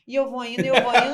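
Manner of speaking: laughing. Real, not acted. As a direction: laugh through the repetition. Laughing at own boldness. (rindo e repetindo)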